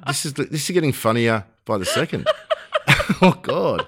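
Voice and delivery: real laughter breaking up the words; laughing. Real, not acted. Host finding the story funnier each second, laughing. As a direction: let the laugh take over mid-sentence.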